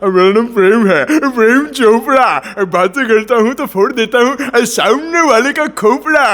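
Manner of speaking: slow, lilting villain catchphrase delivery; smug film baddie, punchy and drawn out; Prem Chopra. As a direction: stretch the final words with a high swoop. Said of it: "Prem Chopra".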